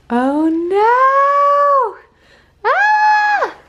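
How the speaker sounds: high, loud playful shriek; playful scream. Acted. Mom at play with her toddler, shrieking gleefully. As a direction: shriek in delight at the game.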